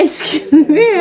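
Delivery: laughing through the words; a real laugh breaking into the line. Real, not acted. Laughing at his remark.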